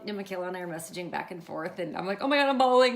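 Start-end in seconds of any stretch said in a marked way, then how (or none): 2.2-3.0 loud, high retold gushing; overwhelmed excitement. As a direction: exclaim it as if overcome with emotion. Retold emotional messages with niece.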